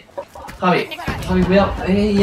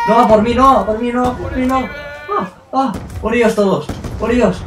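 panicked shouting; 8 semitones higher than usual. Real, not acted. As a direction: yell it in a panic. Pleading as everyone targets him.

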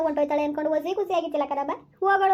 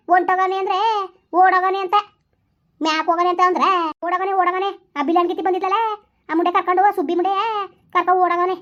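higher, louder angry tirade; furious outburst; 4 semitones higher, 5 dB louder than usual. Acted. Wife raging at the man who ran off with Subbi, loud. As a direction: spit out the insults loudly. Furious at runaway husband and woman.

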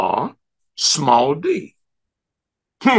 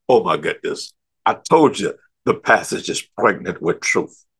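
ordinary talk

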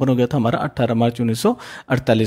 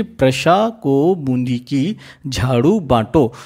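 slowly, word by word; spaced out slowly for learners to catch; slow dictation; much slower than usual. Shown as a demonstration. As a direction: dictate the mnemonic in separated chunks. First dictation of the memory trick.

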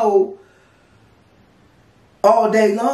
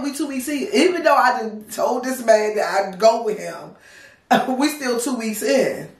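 normal talking voice

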